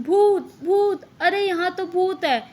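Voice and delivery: high-pitched mock scream of alarm; acted ghost-scare yell; frightened shouting. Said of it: "वो चिल्लाने लगते हैं ज़ोर-ज़ोर से".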